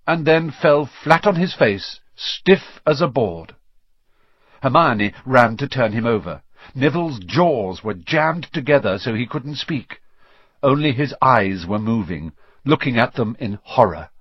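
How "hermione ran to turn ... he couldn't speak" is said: ordinary delivery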